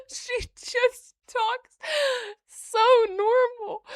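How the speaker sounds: high, slow, laughing-crying outrage; incredulous high squeal; shocked disbelief. Real, not acted. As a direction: gasp and protest in disbelief. Gasping outrage at the speculation.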